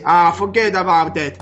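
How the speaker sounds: lightly imitated Italian-American accent; a hint of an Italian gangster accent; De Niro Italian accent. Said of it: "acento italiano". (acento italoamericano imitado, leve)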